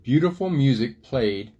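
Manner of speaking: lively picture-book storytelling voice; reading to children. A narrator's reading. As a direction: tell the story to little kids.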